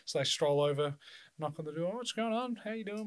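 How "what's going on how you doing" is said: cheery greeting. Retold or imitated. Acting out greeting at the door.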